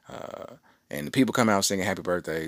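ordinary talk

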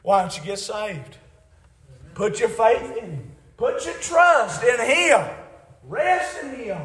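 high, loud, urgent altar call; full-force preaching. Real, not acted. Preacher urging the unsaved to get saved now, full-force preaching. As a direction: preach it high and drawn out, pressing hard.

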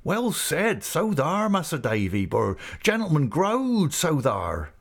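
high, swooping rustic approval; Ham crying out. A narrator's reading. Ham applauding the speech to Mas'r Davy, high and excited. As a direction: cheer him on in eager dialect. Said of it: "cried Ham".

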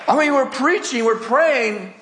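high, exclaiming preacher's climax; impassioned marvelling. Real, not acted. Marvelling climax of grandson's story.

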